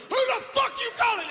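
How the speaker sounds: high, loud, threatening yell; furious shout. Retold or imitated. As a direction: roar at the heckler who insulted him. Toby angrily confronts heckler.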